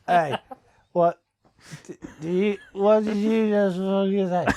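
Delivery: hammed-up hard-man threat; mock aggressive bloke. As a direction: lean in like a bloke picking a fight. Acts out hostile bloke reacting.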